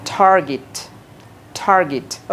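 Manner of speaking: target in American accent, R sounded; American target, tar-git; American English target. Shown as a demonstration. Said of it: "আমেরিকানদের মতো বলো".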